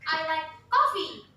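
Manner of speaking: coffee stressed loud, falling; "coffee" punched. Shown as a demonstration. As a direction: hit the word coffee. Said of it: "how about".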